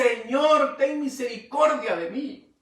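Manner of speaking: loud, high cry for mercy; pleading cry. Acted. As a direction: call out to the Lord in tears. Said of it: "ha derramado sus lágrimas y dice". (súplica fuerte y aguda al Señor)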